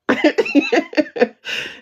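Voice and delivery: high burst of laughter; loud laugh. Real, not acted. Retelling the spades game, a loud high laugh. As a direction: crack up.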